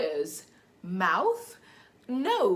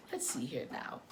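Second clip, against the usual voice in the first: whisper; 10 semitones lower, 17 dB quieter than usual. Real, not acted. Whispered aside while turning pages.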